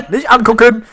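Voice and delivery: höher und lauter hinausgerufen; laut gerufen. Real, not acted. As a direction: die Warnung panisch hinausrufen. Laute Warnung vor dem Enderman. (laut gerufen)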